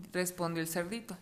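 ordinary delivery

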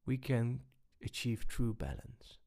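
breathy hush on true balance; whispered. Real, not acted. Coach telling listeners the key to balance, whispered. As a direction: drop to a whisper to land the point. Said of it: "unvoiced whisper on key line".

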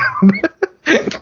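a burst of real laughter, well above the speaking voice; high laugh. Real, not acted. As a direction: cohost cracking up at the story, high laugh. Cohost laughs at the Karen story.

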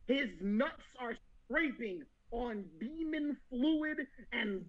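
normal talking voice

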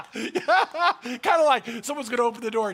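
laughing while talking. Real, not acted. Preacher picturing the person waiting at bathroom, laughing. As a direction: talk through real laughter.

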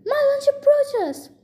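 high-pitched, lightly gloating giant voice. A narrator's reading. Giant greets the charging hero as lunch, gloating. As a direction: crow it high like a hungry giant.

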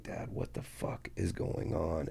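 slow, hushed, whispered question; retold urgent whisper. Son to his dad during the police raid, urgent whisper. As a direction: whisper it across the room to your father.

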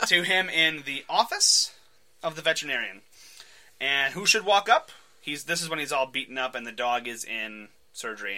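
usual speaking voice

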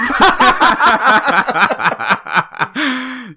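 big high burst of laughter; belly laugh, loud and high. Real, not acted. Laughing at friend's greeting.

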